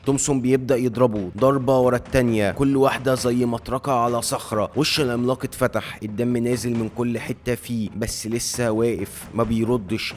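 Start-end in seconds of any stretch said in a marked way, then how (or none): none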